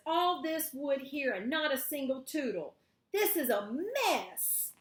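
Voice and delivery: put-on a particular boy fed up with the woods; fussy Valentine. Valentine complains loudly to Noel about the useless wood. Valentine voiced, stick search fails.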